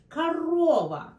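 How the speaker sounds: корова said karOva, the first O as A and the second O stressed; корова with the stress on the middle O; Russian корова. Shown as a demonstration. Teacher models the stressed O for learners. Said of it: "Yeah, clear O".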